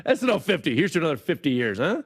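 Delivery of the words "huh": ordinary delivery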